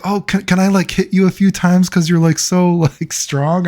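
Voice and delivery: high girlish mock voice with a giggle. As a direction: coo it like the smitten girl. Imitating the girl asking.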